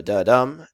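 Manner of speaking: "da-dum" with the second syllable stressed; light "da", strong "dum"; iambic stress. Shown as a demonstration. Teacher confirms the answer to students, iambic stress. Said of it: "you kind of feel that".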